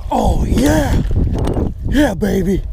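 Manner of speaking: loud, high victory yell; excited shout. Real, not acted. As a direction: whoop it out like a winner. Celebrating a catch.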